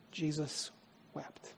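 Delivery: very soft, a hushed Jesus wept; hushed reverent reading. Acted. Preacher reading the verse to his congregation, hushed. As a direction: let the two words fall quiet.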